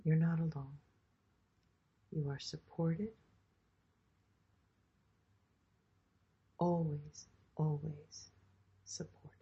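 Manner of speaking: guided meditation. Acted. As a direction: guide them gently into the body. Leads a grounding meditation.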